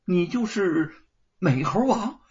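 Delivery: amazed, rising admiration; a farmhand marveling at the Monkey King; admiring wonder. A narrator's reading. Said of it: "目中敬佩不已".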